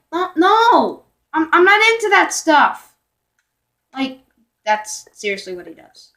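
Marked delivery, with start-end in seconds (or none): 0.1-3.0 high, loud, exasperated no; firm refusal. Retold or imitated. Turning down the kid's crush, high exasperated refusal. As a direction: shut him down, exasperated. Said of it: "I'm like".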